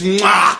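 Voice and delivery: exaggerated smooch noise; loud mock kiss. Kyra kissing a straight woman, hammed-up smack. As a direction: plant a big pretend kiss.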